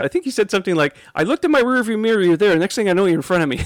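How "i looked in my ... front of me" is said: high and louder, laughing at the end; excited trucker. Retold or imitated. Trucker telling Ian about being passed, amazed. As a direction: marvel at how fast they passed.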